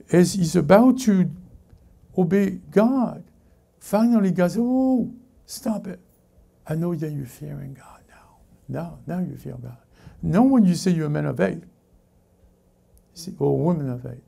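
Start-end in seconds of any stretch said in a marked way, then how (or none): 4.6-9.8 hushed intervention. Acted. God stops Abraham as he obeys, hushed acted voice. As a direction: halt him gently, dropping to a near whisper.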